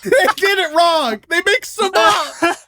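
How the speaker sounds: high laughing shout of frustration; exasperated. Real, not acted. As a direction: vent it, half laughing. Exasperated rant at anime studio.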